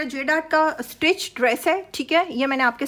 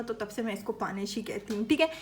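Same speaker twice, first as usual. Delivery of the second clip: normal talking voice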